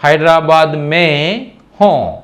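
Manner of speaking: హైదరాబాద్ మే హో spoken slowly, each word set apart; slow teacher demo of Hyderabad మే హో; slow model sentence. Shown as a demonstration. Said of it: "చూడండి, మే".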